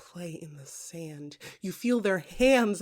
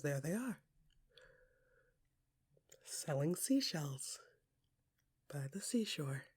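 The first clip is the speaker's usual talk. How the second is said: soft awed whisper; hushed discovery; 5 semitones lower, 13 dB quieter than usual. A narrator's reading. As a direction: whisper it as you spot them. Finds them, awed hush.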